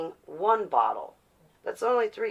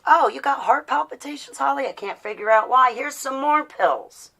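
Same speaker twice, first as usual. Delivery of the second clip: mocking the doctors; 7 semitones higher, 5 dB louder than usual. Mocking doctors handing out pills.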